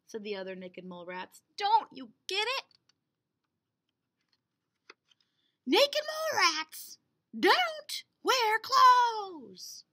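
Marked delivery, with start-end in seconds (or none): 1.6-9.9 high, loud, slowing exasperation; exasperated. Acted. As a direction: spell it out slowly. Mole rats lecture Wilbur.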